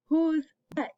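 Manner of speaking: ordinary talk, nothing marked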